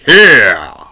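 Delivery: laughing, put-on cheer; mock excitement. Preacher posing as Jesus's gloating killers, mock excitement. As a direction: crow it in fake triumph. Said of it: "Getting excited about killing Jesus".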